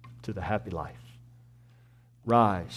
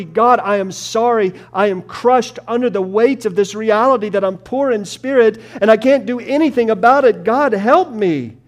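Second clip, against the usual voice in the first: pleading prayer; 6 semitones higher, 8 dB louder than usual. Shown as a demonstration. Preacher voicing a repentant sinner, building to loud pleading. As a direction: start humble and rise into crying out to God. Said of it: "you gotta say".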